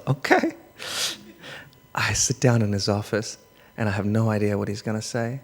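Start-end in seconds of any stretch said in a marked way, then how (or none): 0.1-0.5 very high-pitched mock reply; meek squeak. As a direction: squeak it like a summoned pupil. Summoned like a naughty pupil.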